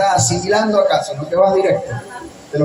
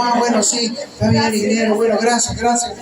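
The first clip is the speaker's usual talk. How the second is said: flustered; 5 semitones higher than usual. Retold or imitated. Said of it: "como asustada".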